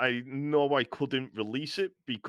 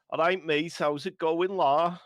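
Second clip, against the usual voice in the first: higher, thick Scouse greeting; Scouse accent; 5 semitones higher, 4 dB louder than usual. Shown as a demonstration. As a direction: demo a young Scouser's twang, pitched up. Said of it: "I've got a little bit of a Scouse twang".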